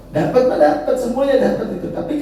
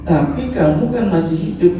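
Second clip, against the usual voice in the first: normal talking voice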